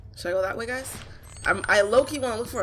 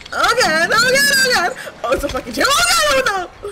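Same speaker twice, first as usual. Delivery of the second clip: high, loud cries of fright; panicked; 14 semitones higher, 7 dB louder than usual. Real, not acted. Player frightened in a dark horror game, panicked. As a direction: panic with your voice leaping up.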